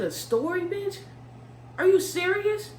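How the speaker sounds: can't believe what she just heard; incredulous. Retold or imitated. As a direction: pitch jumps way up in disbelief. Stunned by friend's reason.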